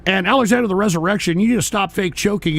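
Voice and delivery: normal talking voice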